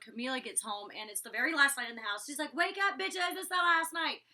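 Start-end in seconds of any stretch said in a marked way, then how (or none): 2.5-4.2 high, loud mock holler; playful raised-voice call; imitated wake-up call. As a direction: rouse the whole house, mimicking a rowdy housemate. Imitates housemate waking everyone up.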